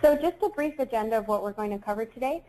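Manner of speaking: ordinary delivery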